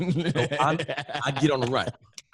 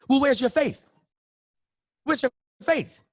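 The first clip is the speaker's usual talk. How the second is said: higher, louder scolding preacher; 10 semitones higher, 4 dB louder than usual. Retold or imitated. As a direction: chide the caller about faith. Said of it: "preacher dad on phone".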